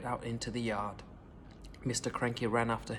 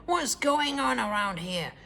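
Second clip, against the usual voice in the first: furious shout; 6 semitones higher than usual. A narrator's reading. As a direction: bellow down from a great height. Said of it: "she shouted".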